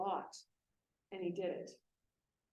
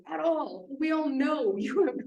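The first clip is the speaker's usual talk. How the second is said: loud, high teasing laugh through the words; laughing retort; 6 semitones higher, 12 dB louder, slower than usual. Retold or imitated. Teacher teasing her student about his writing, laughing loudly. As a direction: laugh him off affectionately.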